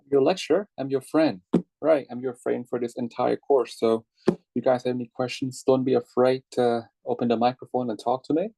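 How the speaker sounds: ordinary talk, nothing marked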